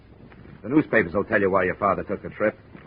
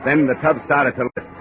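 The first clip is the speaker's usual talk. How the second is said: tense noir voice-over, building with the action; hard-boiled narration; 2 semitones higher than usual. A narrator's reading. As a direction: tell it like a weary detective looking back. Hard-boiled first-person narration over music.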